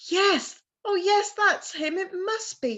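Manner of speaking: high, eager yes; excited Gerda. Acted. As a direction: burst out with hope. Gerda recognises Kay.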